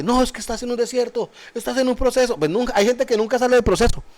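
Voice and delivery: high mocking singsong voice; mocking people's excuses. Mocking what people tell you.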